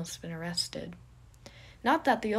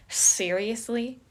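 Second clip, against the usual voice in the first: boastful Remus, mocking; 9 dB louder than usual. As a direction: show off to your friend, teasing. Remus voiced loud and boastful.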